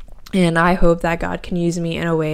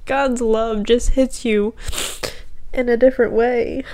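tearful; 3 semitones higher than usual. Real, not acted. Still tearful about God's love.

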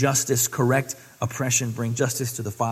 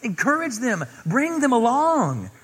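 higher, pleading exhortation; earnest higher urging; Paul urging Timothy; 9 semitones higher than usual. Acted. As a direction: encourage him like a mentor. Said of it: "No, he says".

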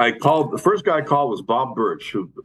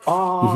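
choir; 9 semitones higher than usual. Retold or imitated. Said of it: "I heard the choir".

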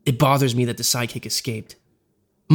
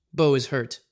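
plain reading voice

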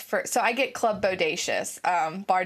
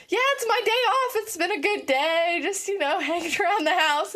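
much higher, overly bright reply; fake casual cheer; 8 semitones higher than usual. To the caller after the yelling, fake breezy cheer. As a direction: cover the embarrassment with chirpy cheer. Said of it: "And I was like".

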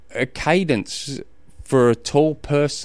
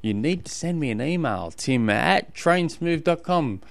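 podcast intro voice; 4 semitones higher than usual. Acted. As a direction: welcome listeners like a radio host.

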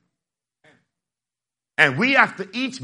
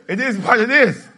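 laughing; 5 semitones higher, 4 dB louder than usual. Real, not acted. Laughing punchline.

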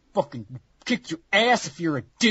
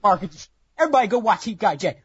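ordinary delivery

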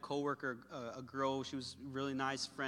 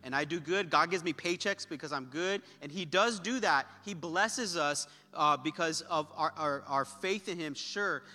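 forceful preaching, growing louder towards the end; impassioned preaching; 3 semitones higher, 6 dB louder than usual. Real, not acted. Preacher assuring congregation God shows up in pain, impassioned. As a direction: drive the message home to the room.

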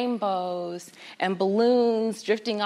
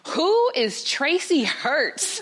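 slow, raised, puzzled call; baffled mother calling out; 6 semitones higher, 4 dB louder, much slower than usual. Acted. Mother calling from the kitchen over mail, baffled. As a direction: stretch the question out, higher, as an acted mother.

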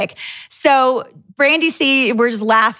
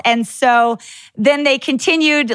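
usual speaking voice

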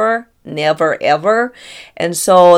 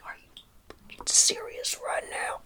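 hushed disbelieving whisper; stunned whisper; 11 dB quieter than usual. Retold or imitated. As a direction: whisper it in shock. Same birthday shock.

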